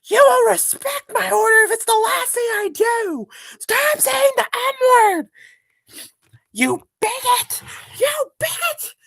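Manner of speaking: high screamed rant, dragged-out words; hammed-up screaming meltdown, far above normal pitch; Copper raging. Streamer shows chat how Copper rages, shrieked mock fury. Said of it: "we can talk about Copper raging".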